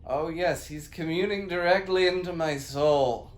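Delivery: high, loud possessed voice; booming demon lord. Acted. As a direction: boom like a spirit took over. Possessed by Earth God.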